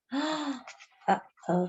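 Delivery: put-on scared child gasping under her breath; hushed frightened gasp. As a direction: breathe it out in quiet dread. Narrator sees men plan burning.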